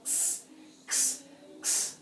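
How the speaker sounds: whispered X sound, no voice. Shown as a demonstration. Teacher demonstrating the X sound, breathed and unvoiced. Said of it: "That sound".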